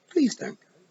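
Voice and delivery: Tiffany hurriedly begs the feegle to stay; quick plea. Acted. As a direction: call out before he vanishes. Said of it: "she began quickly".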